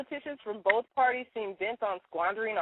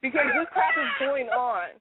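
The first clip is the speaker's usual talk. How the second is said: much higher, louder indignant outburst; pissed off; 4 dB louder than usual. Real, not acted. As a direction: let the anger spike on the last line. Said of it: "Yes, I'm that, that pissed off".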